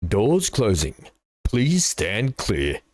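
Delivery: slow, flat automated door announcement; train announcement voice. Retold or imitated. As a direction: read it like a recorded train message. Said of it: "In, in exactly that voice".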